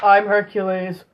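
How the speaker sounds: ordinary delivery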